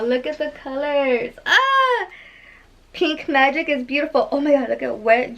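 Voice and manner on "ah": high, loud squeal of delight; excited squeal. Real, not acted. Vlogger seeing the colors, high excited squeal. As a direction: squeal at something gorgeous.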